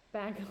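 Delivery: "Bagel" said as BAG-ul; mocked bagel pronunciation. Shown as a demonstration. Slipping into the version she hates, "Bagel" as BAG-ul. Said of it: "I just said bagel".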